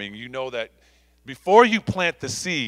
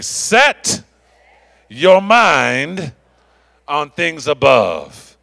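with conviction; 9 dB louder, much slower than usual. Shown as a demonstration. Pastor showing the congregation how to say it, loud with conviction. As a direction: declare it with full conviction. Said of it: "say that with some conviction".

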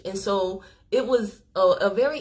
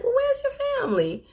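far higher, gently surprised "where's your family"; high concerned question. Retold or imitated. Asking the young man about his family, high and concerned. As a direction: ask it with a high, caring lift.

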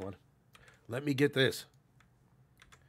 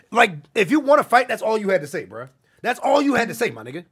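loud, higher-pitched outburst; heated challenge; 7 semitones higher, 10 dB louder than usual. Real, not acted. Streamer calling out someone who wants to fight, heated and loud. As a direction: throw it out as an indignant challenge.

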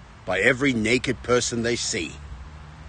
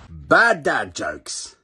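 drawn-out, deadpan intro call; slow title announcement; 4 dB louder, much slower than usual. Acted. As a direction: announce it slowly like a show title.

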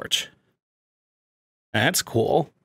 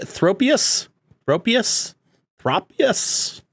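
sounding out; 10 semitones higher, much slower than usual. Real, not acted. Sounding out unfamiliar name.

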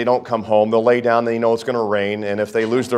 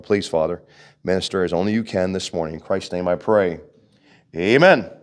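prayer; 4 semitones lower than usual. Acted. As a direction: pray it humbly, drawing inward. Prays aloud to the Father.

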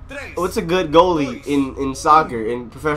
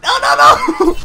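high, rapid cry of alarm; panicked shout; 4 dB louder, slower than usual. Real, not acted. Gamer reacting to a play going wrong, panicked shout. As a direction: yell it out in real panic.

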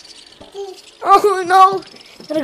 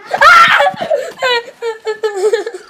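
shrieking laugh; 11 semitones higher, 10 dB louder than usual. Real, not acted. Laugh cry shout heard.

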